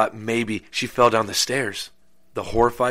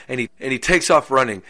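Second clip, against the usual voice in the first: ordinary delivery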